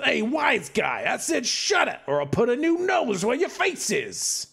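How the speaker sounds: menacing thug. Acted. Yo-yo boy threatens the barkeep, snarling tough guy.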